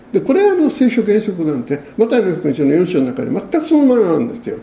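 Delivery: normal talking voice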